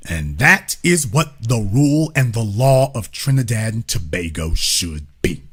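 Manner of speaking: low, measured decree; solemn pronouncement. Real, not acted. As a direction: lay it down like a ruling, deep and soft. Solemn verdict after the host act.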